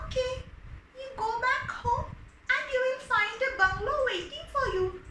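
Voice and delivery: a small magical creature granting a wish; lightly voiced kind fish.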